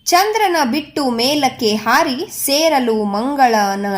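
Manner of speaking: put-on measured, lilting poem reading for children; poem recitation. Teacher reads the Putti poem to grade six children, lilting recitation. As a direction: recite each verse line in rhythmic phrases.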